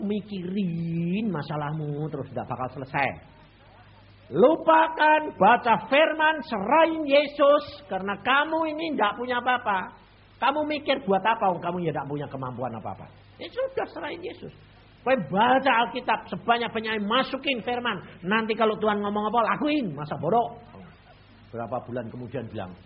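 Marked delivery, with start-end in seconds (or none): 4.3-11.0 loud, slow, high exhortation; forceful preaching. Acted. 13.4-20.6 loud urgent exhortation; fervent preaching. Acted. Preacher exhorts at full force.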